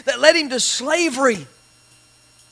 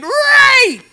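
a sudden high piercing scream of one word; screamed accusation. Retold or imitated. Woman falsely accusing Joseph, screaming at full pitch. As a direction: scream it out as the woman cries foul. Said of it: "She screamed".

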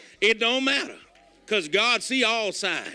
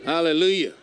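low hallelujah; 7 semitones lower than usual. Acted. Preacher's low exclamations.

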